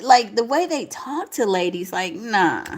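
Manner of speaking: usual speaking voice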